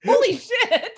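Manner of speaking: loud astonished shout; shocked shout. Real, not acted. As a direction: blurt it out in shock. Reacting to critical roll.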